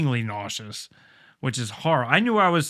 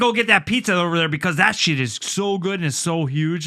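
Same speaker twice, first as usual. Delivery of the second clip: higher, louder hyped-up Canadian voice; animated Canadian buddy; 5 semitones higher, 4 dB louder than usual. Retold or imitated. Imitating his Canadian friend Curtis, higher and louder. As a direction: gush about the pizza like an excited Canadian. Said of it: "he's a little bit more animated".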